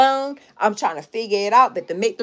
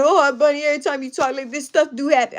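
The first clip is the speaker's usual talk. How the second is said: higher-pitched, hurried worried voice; scared niece; 5 semitones higher than usual. Retold or imitated. Niece telling her aunt such warnings come true, higher and worried. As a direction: imitate a frightened niece fretting. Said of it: "she started getting scared".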